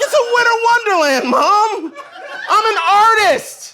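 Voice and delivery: high, loud, fast, laughing insistence; mock-offended artist yelling through laughs; laughing protest. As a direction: shout it through laughter like a proud kid. Defending his art, laughing.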